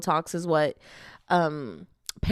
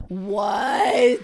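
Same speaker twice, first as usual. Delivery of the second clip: startled shout; 4 dB louder than usual. Real, not acted. Startled outburst.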